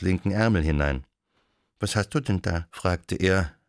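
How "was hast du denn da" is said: usual speaking voice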